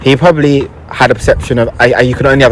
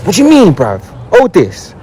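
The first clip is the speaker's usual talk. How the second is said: high insistent street voice; pushy older boy; 7 semitones higher than usual. Retold or imitated. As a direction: push him, higher and demanding.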